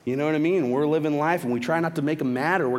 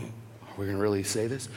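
hushed, low, hesitant; doubtful inner thought; 7 semitones lower, 8 dB quieter than usual. Retold or imitated. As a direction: mutter it low to yourself. Doubting thought before answering the lady.

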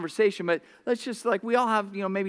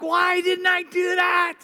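self-scolding shout; 9 semitones higher than usual. Retold or imitated. Driver mocks his own mistake with a high shout. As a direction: berate yourself for the forgotten gas.

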